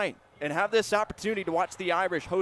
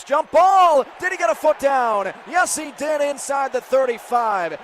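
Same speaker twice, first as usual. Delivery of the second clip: very loud, higher shout; excited jump ball call; 7 semitones higher, 10 dB louder than usual. Real, not acted. Announcer shouting on a contested sideline catch. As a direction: snap it out as the ball hangs in the air.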